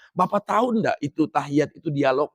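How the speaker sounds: normal talking voice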